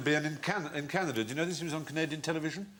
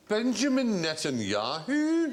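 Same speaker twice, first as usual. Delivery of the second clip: slow, higher Scottish drawl of the name; Billy Connolly impression; 8 semitones higher, slower than usual. Connolly on the phone, slow Scottish riff. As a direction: roll the name out slowly in Scots. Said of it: "He just went".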